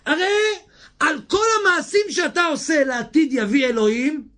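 fervent preaching shout. Real, not acted. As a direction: thunder the verse out. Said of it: "preacher shouting verse".

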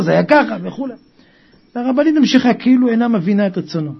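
ordinary delivery

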